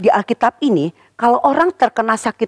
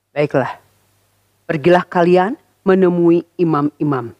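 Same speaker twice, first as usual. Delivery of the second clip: calm Jesus; 6 semitones lower than usual. Acted. Jesus speaks calmly.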